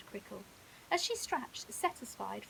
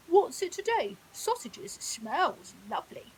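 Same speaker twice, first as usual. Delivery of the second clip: hippo voice; 9 dB louder than usual. Acted. Hippo Henry speaking.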